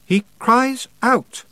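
put-on slow, deliberate boy insisting; boy repeating firmly. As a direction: hold the line, slowly. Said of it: "Roland repeated".

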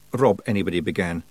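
ordinary delivery, nothing marked

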